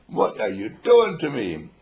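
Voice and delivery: high, slow, loud indignant wail; hammed-up aggrieved cry; mock baby protest. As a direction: protest like an outraged newborn. Newborn protesting at birth.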